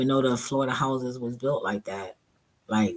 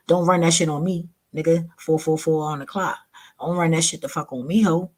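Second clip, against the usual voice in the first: loud, higher and taunting; calling him out; 5 semitones higher, 4 dB louder than usual. Retold or imitated. Teller confronting the would-be pimp, loud taunting callout. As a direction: shut down his hustle.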